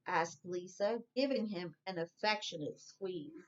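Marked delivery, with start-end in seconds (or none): none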